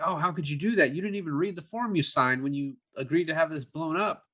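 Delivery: ordinary talk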